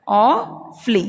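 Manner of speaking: awfully broken in two, AW, pause, FLEE; slow two-part demo of awfully; split, no u. Shown as a demonstration. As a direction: sound out awfully slowly as "aw... flee". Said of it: "fully नहीं है".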